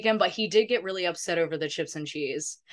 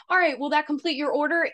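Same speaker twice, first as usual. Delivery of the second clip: drive-thru worker; 7 semitones higher, faster than usual. Retold or imitated. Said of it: "the woman's like".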